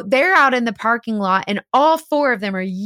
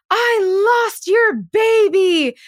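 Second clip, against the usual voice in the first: high, slow, theatrical grieving woman; acted dramatic quote; 9 semitones higher, 3 dB louder, much slower than usual. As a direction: play the girlfriend wailing her loss, drawn out. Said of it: "tells the guy in front of her husband".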